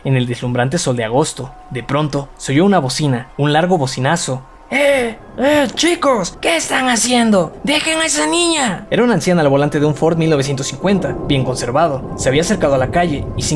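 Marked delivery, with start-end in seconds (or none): 4.7-8.9 put-on much higher, scolding old lady; an old driver shooing boys away; high, shocked old woman's scold; indignant old woman. Old woman yells from car.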